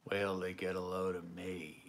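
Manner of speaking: low, hushed villain impression; Jack Nicholson's Joker. Host voices the line heard in their head, Joker impression. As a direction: drop it low and quiet, like a comic-book villain. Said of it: "heard it in my head as Jack Nicholson's Joker".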